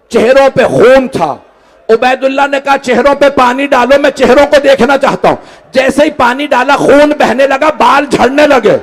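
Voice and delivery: lamenting preacher driving to a high-pitched climax; impassioned mourning sermon. A narrator's reading. Preacher recounts the court scene to mourners, impassioned lament. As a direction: declaim with mounting force, stretching the final cry.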